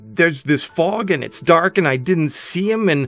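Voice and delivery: loud, high panic building into a frantic plea; panicky, desperate. A narrator's reading. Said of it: "the panicky, desperate descriptions".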